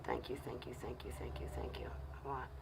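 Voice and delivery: soft breathless whisper of thanks; humbled, grateful whisper to a viewer. Real, not acted. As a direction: whisper it, deeply moved.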